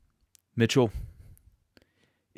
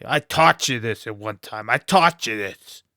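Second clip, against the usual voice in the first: imitating his father; 7 dB louder than usual. Son imitates his construction worker father scolding him. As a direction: scold like an impatient dad. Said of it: "That's how he would talk".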